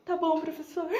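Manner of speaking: high, tearful mock whimper; meek fake crying. Sly pupil answering the teacher's apology, meek fake crying. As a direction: accept it through put-on sobs. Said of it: "E eu bem sonsa".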